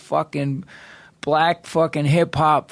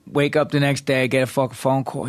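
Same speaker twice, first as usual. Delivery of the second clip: ordinary talk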